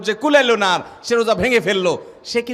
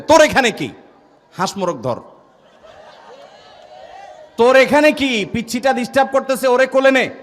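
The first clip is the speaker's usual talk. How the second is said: put-on loud, higher scolding bark at the child; scolding shout; 6 semitones higher, 4 dB louder than usual. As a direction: chase the kid off with a sharp yell. Grandparents scolding the child who broke the fast. (দাদা-দাদির জোরে ধমক)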